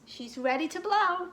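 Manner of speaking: usual speaking voice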